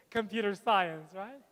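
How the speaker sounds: ordinary talk, nothing marked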